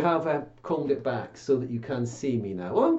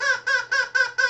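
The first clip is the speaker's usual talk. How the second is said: very high squeaky puppet cackle; a bird puppet's shrill chuckle; high cartoon bird laugh; puppet squawk laugh; 3 dB louder than usual.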